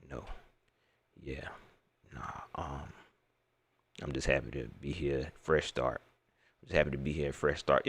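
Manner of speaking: low, soft, clipped one-word answers; flat press conference mumble. Retold or imitated. Imitating the player's low, barely-there press answers. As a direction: mumble like a player who doesn't want to talk. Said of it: "he's like".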